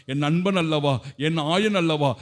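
ordinary delivery

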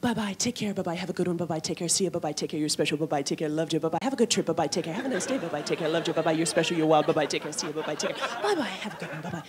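sing-song autopilot goodbyes, a hint of flight attendant. Flight attendant saying goodbye to every passenger, mock-chirpy and soft. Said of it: "They're so friendly then, going".

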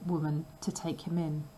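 ordinary delivery, nothing marked

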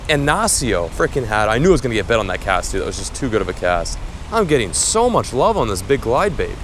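usual speaking voice

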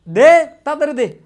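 loud, high scolding teacher; mock scolding shout. Real, not acted. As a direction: yell at the class to hurry up. Teacher scolds students to answer. (ধমক দিয়ে চিৎকার)

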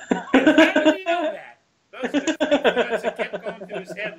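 high, loud laughing disbelief; cracking up while telling it. Real, not acted. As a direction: laugh it out, amazed he knew. Laughing at the FBI guy.

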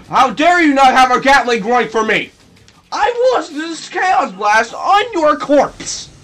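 high, loud, hammed-up shouting; mock outrage. Player scolding a robot with no Gatling gun, mock outrage. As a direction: rant theatrically, drawing out the final threat.

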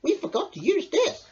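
excited hedgehog voice; Hedgehog crying out. A narrator's reading. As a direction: show the acorn eagerly. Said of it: "he cried".

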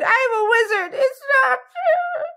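very high, wailing denial; high wail, slowing and stretched at the end; crying out. A narrator's reading. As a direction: shriek it out while melting away. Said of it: "his cries stopped".

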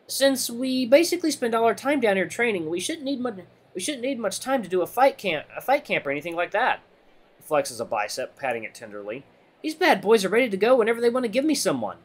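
high, breezy boasting; confident fighter buddy. Acted. Redline boasting about being ready to fight, confident and high.

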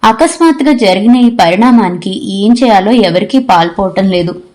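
ordinary delivery, nothing marked

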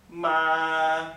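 "ma" loud, long and level up high; "ma" as a flat, high sustained tone; first tone. Shown as a demonstration. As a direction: hold "ma" on one high note, no bend. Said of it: "the first tone is a flat tone like this".

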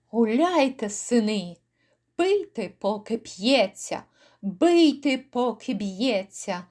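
ordinary delivery, nothing marked

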